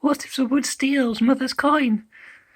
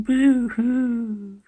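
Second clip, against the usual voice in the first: mock boo-hoo sob. Kittens complaining to mother.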